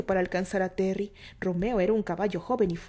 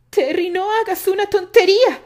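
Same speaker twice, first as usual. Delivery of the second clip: high, loud warning shout; 10 semitones higher, 12 dB louder than usual. A narrator's reading. Candy shouts a warning to Terry, high and loud. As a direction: shout to stop him. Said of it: "gritó Candy".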